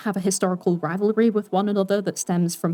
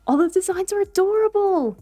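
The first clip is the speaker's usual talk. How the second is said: much higher and louder, gushing; excited squeal; 13 semitones higher, 4 dB louder than usual. Real, not acted. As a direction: squeal with delight over cute art. Gushing over designs.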